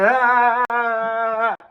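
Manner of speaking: loud imitation belly laugh; imitated belly laugh. Storyteller imitating mama laughing at the fall, mock belly laugh. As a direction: mimic mama's big stomach laugh, drawn out. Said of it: "genuine laughs that comes from the stomach".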